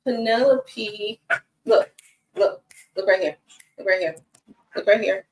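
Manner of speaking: normal talking voice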